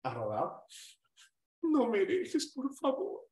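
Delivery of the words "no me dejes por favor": high, slow, begging lover; hammed-up plea not to be left; mock pleading. Acted pleading to ex. (súplica exagerada y lenta)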